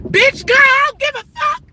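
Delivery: real high, loud road-rage scream; furious shouting. Real, not acted. Late parent screaming at a stalled driver, furious shout. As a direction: scream at the car in your way to move.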